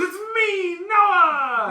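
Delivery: high, loud, drawn-out cry; mock triumphant yell. Relative pops out as the gift, high mock yell. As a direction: shout it out like a big surprise reveal. Imitates gift revealing himself.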